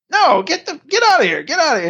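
high, dismissive put-on voice; mock shooing guy. Retold, the customer chases Leon off, high and dismissive.

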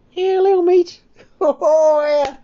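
a squeaky, excitable mate greeting a pal; high, loud and chirpy; Tommy's voice. Acted.

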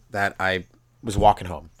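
usual speaking voice

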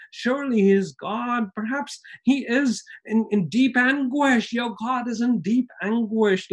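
high, sarcastic mock sympathy; mocking taunt. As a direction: tease them about their silent god. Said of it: "having a little bit of fun".

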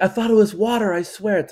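high, loud pleading excuse; frantic protest. Acted. Driver pleads with police over a DUI, frantic protest.

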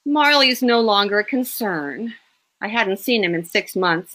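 plain reading voice

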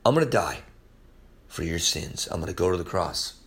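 low, soft voice of grave sincerity; solemn, hushed. Acted. Preacher voicing Jesus before the cross, solemn and hushed. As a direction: drop low and let it land quietly.

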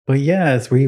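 ordinary talk, nothing marked

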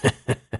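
short acted chuckle; laugh. A narrator's reading. Cousin laughs at his companion's hesitation, a short chuckle. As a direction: chuckle in character before speaking. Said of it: "杨楠笑道".

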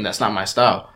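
usual speaking voice

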